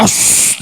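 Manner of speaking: sharp voiceless "shh" imitating father; stern warning shush. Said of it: "dengan muka yang garang". (desisan teguran ala papa galak)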